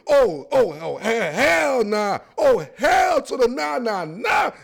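rising, loud oh-no outcry building to a shout; disbelieving outcry. Real, not acted. Talking about the Titans' size, a loud disbelieving outcry. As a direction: cry out in disbelief, hell nah.

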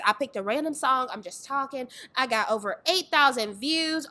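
usual speaking voice

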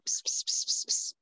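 whispered psst psst to a cat; kitty-calling psst. Shown as a demonstration. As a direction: hiss softly like calling a kitten. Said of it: "That's literally what I named him".

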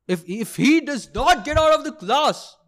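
high, mock-furious professor's ultimatum; threatening professor. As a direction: imitate the professor yelling a threat. Professor threatens the whole class.

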